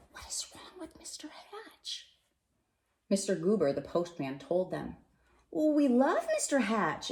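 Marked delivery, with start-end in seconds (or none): 0.1-2.1 breathless hushed question; whisper. Acted. Neighbours whispering worriedly about Mister Hatch. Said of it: "Everyone whispered". 5.5-7.1 higher, earnest neighbour voice; insisting. Acted. The Dunwoodys insisting to the neighbours, higher and earnest. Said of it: "insisted Mister and Missus Dunwoody".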